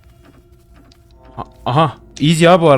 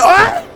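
startled yelp; 3 dB louder than usual. Real, not acted. Scared yelp in horror game.

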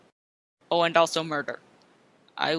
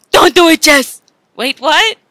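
normal talking voice

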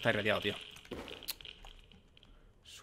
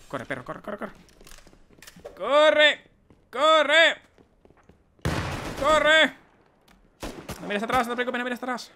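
being chased; 6 semitones higher, 5 dB louder, slower than usual. Real, not acted.

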